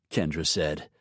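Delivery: ordinary delivery, nothing marked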